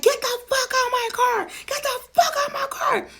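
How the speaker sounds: very high, tearful screaming order. Retold or imitated. Cousin screaming at her to get out of the car, high and tearful. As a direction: scream it, losing control. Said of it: "she's like".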